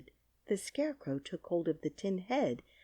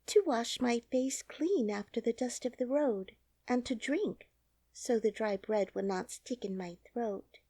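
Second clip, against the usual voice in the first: put-on higher, light girlish voice; a lightly voiced little girl explaining simply; girlish storybook voice; young girl Dorothy; 6 semitones higher than usual.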